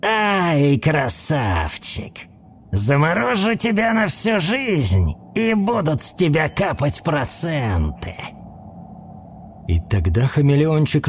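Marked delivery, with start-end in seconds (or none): 0.0-8.1 creepy creaky villain voice; dry creaky voice. A narrator's reading. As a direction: creak it out menacingly. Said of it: "сказал своим сухим и скрипучим голосом".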